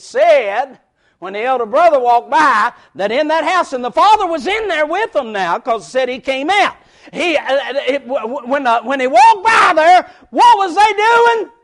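loud, fast preaching; fiery preaching. Acted. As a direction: preach it out to the congregation. Preaching at full force.

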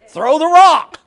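preacher hollers the punch line; shouted exhortation. Acted. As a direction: hurl the line out at the congregation.

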